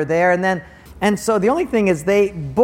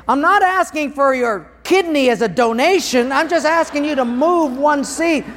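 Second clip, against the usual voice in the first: indignant retort; 9 semitones higher, 4 dB louder than usual. Retold or imitated. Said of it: "I wanted to say".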